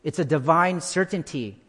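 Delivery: ordinary talk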